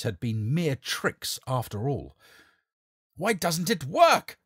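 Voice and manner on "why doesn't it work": slow, loud, higher furious demand; screamed. Acted. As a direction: shriek at the charlatan in panic. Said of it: "the king screamed at the charlatan".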